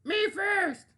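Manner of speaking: high, loud greedy piglet cry; pushy pig crying out. Acted. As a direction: shout it like a pig who must be first. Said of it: "he cried at story time".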